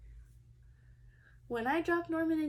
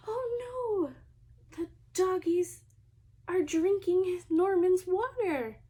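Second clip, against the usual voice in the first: high alarmed cry, getting louder; 4 semitones higher, 4 dB louder than usual. Acted. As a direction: cry out in alarm as the dogs drink the water. Dogs drink the fish's water.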